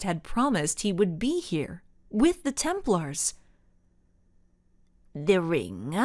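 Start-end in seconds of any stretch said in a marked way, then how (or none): 5.1-5.9 low, demanding villain. A narrator's reading. Rossi to Ava, a low demand. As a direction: press for the ring, low. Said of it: "demanded Rossi".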